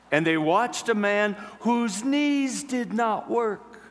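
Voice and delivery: high, slow, swelling preacher's telling; preaching narration. Acted. Preacher telling the congregation of the healed man, high and slow. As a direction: lift each phrase up and draw it out.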